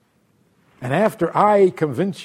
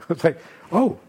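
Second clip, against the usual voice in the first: ordinary talk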